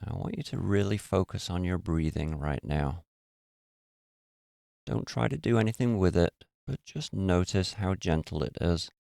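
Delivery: soft, even voice with long pauses; guided meditation. Real, not acted.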